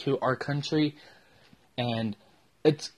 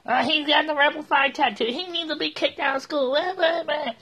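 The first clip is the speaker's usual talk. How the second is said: high, whiny mock of a complainer, much higher than usual; mocking tattletale; 9 semitones higher than usual. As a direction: whine it like a tattling kid, trailing into blah blah. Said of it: "Someone fucking bitch".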